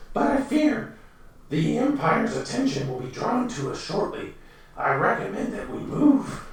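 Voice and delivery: high, soft, scheming goblin voice; servile goblin advisor. Acted. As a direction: advise the master in a sly, hushed high voice. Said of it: "He says".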